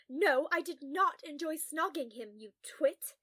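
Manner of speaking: loud, emphatic scolding; indignant. A narrator's reading. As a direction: snap back, offended by the suggestion. Scolding a colleague for teasing.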